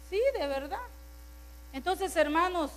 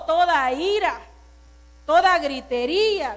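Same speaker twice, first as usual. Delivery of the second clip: emphatic scripture preaching; 3 semitones higher, 7 dB louder than usual. Acted. Preacher citing scripture forcefully.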